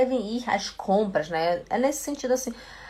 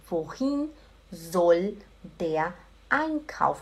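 slow, word-by-word with pauses; repeat-along model; 4 semitones lower, much slower than usual. Shown as a demonstration. Teacher leading students through a question, slow and word by word. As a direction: stretch each word and leave gaps. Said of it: "Repete junto comigo".

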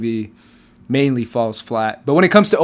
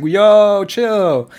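mock Anakin; 7 semitones higher, 5 dB louder than usual. Mocking Anakin's line, voiced.

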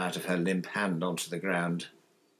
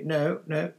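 higher, absent-minded voice; distracted; 6 semitones higher, 4 dB louder than usual. A narrator's reading. Mother telling boy no post came, distracted. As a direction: answer with your mind elsewhere, pitched up.

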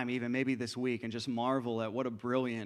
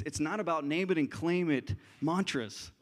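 ordinary talk, nothing marked

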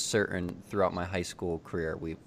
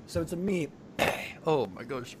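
husky, just-woke-up voice; morning voice; 8 semitones higher than usual. Real, not acted. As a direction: speak through a rough morning throat. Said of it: "my morning voice is just becoming even more morninger".

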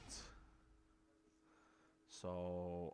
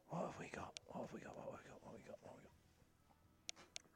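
each repeat softer until a breathy whisper; fading to whisper; 7 semitones higher, 13 dB quieter than usual. Acted. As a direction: let it die away to nothing.

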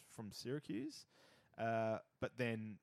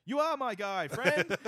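higher, louder mock Canadian fan; cheerful Canadian fans in a light put-on voice; lightly hammed Canadian accent; 8 semitones higher, 12 dB louder, slower than usual. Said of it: "they were saying the opposite".

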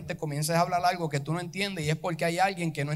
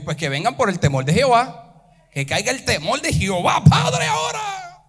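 louder, rising to a high shout; full-force preaching; 6 semitones higher, 9 dB louder than usual. Acted. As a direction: cry out for the fear of God. (clamor de predicación que sube a grito)

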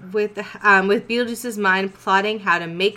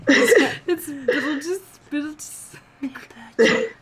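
laughing; 7 semitones higher than usual. Real, not acted. Laughing at the name.

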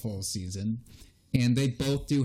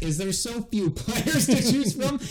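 laughing; 10 semitones higher than usual. Real, not acted. Laughing disbelief at agreeing.

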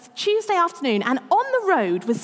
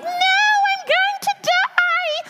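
screaming house plant; 4 dB louder than usual. Pretend. Houseplant dreading her house, squealing high. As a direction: wail like a terrified little plant. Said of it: "They scream as they come".

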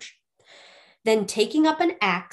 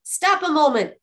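higher, louder exclamation; crying out; 6 semitones higher, 5 dB louder than usual. A narrator's reading. Youth stopping by the cold body, crying out. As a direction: call out to halt them. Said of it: "he cried".

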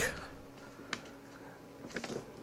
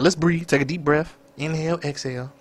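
softer, fading calming instruction; calming coach; 7 dB quieter than usual. Retold or imitated. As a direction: talk her down gently. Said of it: "I be like".

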